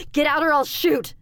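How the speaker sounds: high, loud threatening yell; shout. A narrator's reading. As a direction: shout a panicked warning at an intruder. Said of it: "I shouted at him".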